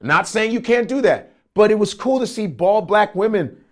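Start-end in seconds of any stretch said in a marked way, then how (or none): none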